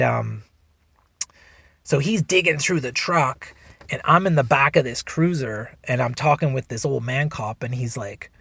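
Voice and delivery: ordinary delivery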